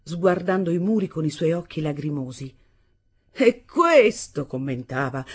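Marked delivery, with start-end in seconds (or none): 3.3-4.5 louder, slow, higher sneering jab; scornful angry mother. A narrator's reading. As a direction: spit it out like an enraged she-bear. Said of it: "come un'orsa inferocita".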